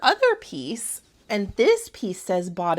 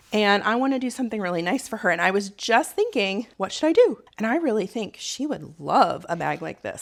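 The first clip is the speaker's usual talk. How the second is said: ordinary talk, nothing marked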